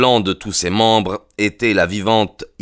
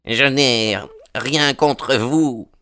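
strained, slow and high, as if half-choked; halting, high, choked retreat; stammering; 5 semitones higher, much slower than usual. A narrator's reading. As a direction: stammer it out through a sore throat. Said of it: "bégaya Baldwin en se frictionnant la gorge".

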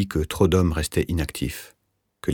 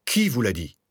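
higher, alarmed question without real shouting; mild cry; 6 semitones higher than usual. A narrator's reading. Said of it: "cria le rentier". (cri contenu)

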